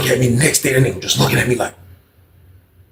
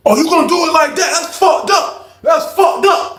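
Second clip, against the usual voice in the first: high, loud slow outrage; high whining shout from the man who lost his tray; high offended shout; 3 dB louder than usual. Retold or imitated. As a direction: complain loudly that it's unfair. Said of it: "He like".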